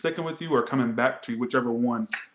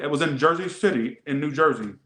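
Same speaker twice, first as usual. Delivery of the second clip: ordinary talk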